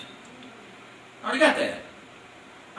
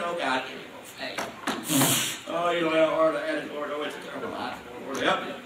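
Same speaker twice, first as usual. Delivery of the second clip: whining prayer; 6 semitones lower, 5 dB quieter than usual. Retold or imitated. Believer whining to God for more faith, mock lament. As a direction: whine to God like a self-pitying believer. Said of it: "whining, crying, and screaming".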